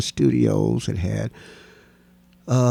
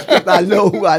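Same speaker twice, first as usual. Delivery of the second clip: normal talking voice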